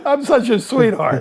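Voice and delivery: high, laughing self-mockery. Real, not acted. Speaker joking about stealing sister's money but leaving cab fare, laughing. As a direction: say it through helpless laughter. Joking at own past theft.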